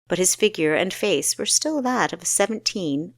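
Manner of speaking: ordinary delivery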